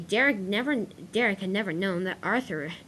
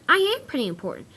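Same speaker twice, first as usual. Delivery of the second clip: high, louder storybook voice; cheered up; 9 semitones higher, 5 dB louder than usual. A narrator's reading. Arthur thanking the engines for their advice, cheered up. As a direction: perk up with newfound confidence.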